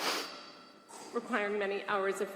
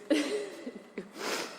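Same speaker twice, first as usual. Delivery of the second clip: a choked little laugh breaking into tears; embarrassed sob-laugh; real crying laugh, caught off guard; teary laugh-sob; 9 semitones higher, 4 dB quieter than usual. Real, not acted. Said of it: "I don't know why I'm crying that much".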